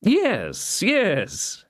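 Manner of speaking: high, mocking villain's voice; scornful baron. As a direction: taunt the prisoner from above.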